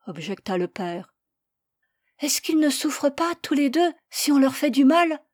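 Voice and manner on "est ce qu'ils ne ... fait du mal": put-on high, loud pleading little boy; child's voice. Boy begging his parents to spare the lamb, high and loud. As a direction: plead like a small boy defending his pet.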